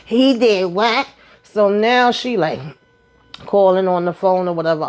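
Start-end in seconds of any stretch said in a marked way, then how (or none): 0.1-1.1 slow, high and stunned; mock shocked "he did what"; surprised. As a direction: drag out the disbelief. Retold shock over Zach's check.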